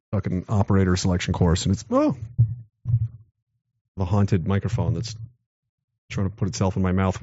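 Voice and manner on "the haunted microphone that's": usual speaking voice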